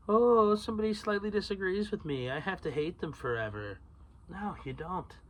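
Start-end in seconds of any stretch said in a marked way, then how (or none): 0.1-3.8 high mocking whiny voice, dropping down; mocking whine. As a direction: sneer at thin-skinned people. Mocking touchy people.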